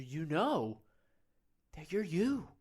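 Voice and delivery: normal talking voice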